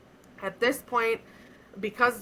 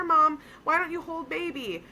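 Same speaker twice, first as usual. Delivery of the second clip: higher, sing-song nurse soothing a patient; sweet nurse voice; 8 semitones higher than usual. Retold or imitated. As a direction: coax like a gentle nurse handing over a baby. Nurse voiced in retold scene.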